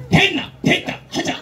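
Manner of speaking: clipped Gyeongsang man, no small talk; curt Gyeongsang. Retold or imitated. Gyeongsang man gets straight to the point in curt dialect. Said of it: "경상도 사람들은 화끈해".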